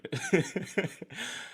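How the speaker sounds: high, soft laugh; real giggle, light and high; amused giggle. Real, not acted. Streamer told her Southern accent should shine, amused giggle.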